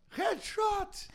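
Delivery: high shouted headshot call; esports caster. Retold or imitated. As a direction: yell it like a shooter caster. Joins in imitating game casters. (narrador gritando headshot)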